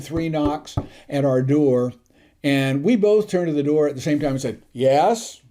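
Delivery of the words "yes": lightly officious one-word answer; imitating an official reply; official upperclassman tone. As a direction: answer like a senior cadet on duty. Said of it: "in a very official upperclassman type tone".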